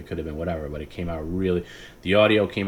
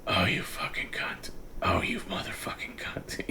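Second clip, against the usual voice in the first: slow whispered cursing; imitating whispered sleep curses; sleep-talk whisper; 9 dB quieter than usual. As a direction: mutter it half asleep. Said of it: "he was just like".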